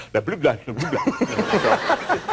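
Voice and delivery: garbled fake-Russian babble; mock Russian. Translator passing the remark on, mock Russian babble. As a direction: gabble nonsense in mock Russian.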